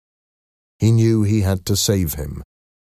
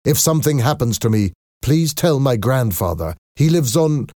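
raised, hurried plea; urgent request; 6 semitones higher than usual. A narrator's reading. Marius asks Valjean to tell grandfather, urgent. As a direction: plead it quickly before time runs out.

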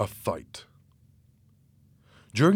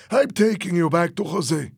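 high, raised-voice warning; acted heated declaration; angry threat; 8 semitones higher, 4 dB louder than usual. A narrator's reading. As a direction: lay down the law at gunpoint. Threat at gunpoint.

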